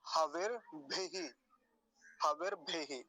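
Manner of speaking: हविर भेही said slowly, र joined to हविर; slow recitation demo. Shown as a demonstration. Teacher demonstrates mantra word to students, slow and clear. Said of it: "ऐसे".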